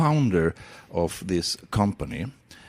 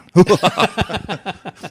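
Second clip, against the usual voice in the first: loud laugh; 9 semitones higher, 9 dB louder than usual. Real, not acted. Joking about competition.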